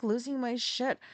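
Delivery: normal talking voice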